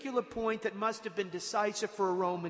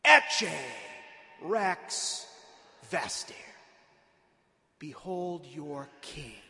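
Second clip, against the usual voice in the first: contemptuous; 3 semitones lower, much slower than usual. Acted. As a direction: proclaim it with scorn, word by word. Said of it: "with a sense of contempt".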